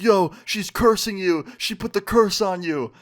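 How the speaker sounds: high, loud imitation of jeering friends; mock teasing hoot. Friends on a call teasing him about the curse, imitated high and excited. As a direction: holler it like friends ribbing a buddy. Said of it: "They're laughing so fucking hard at me".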